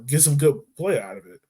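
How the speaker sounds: ordinary talk, nothing marked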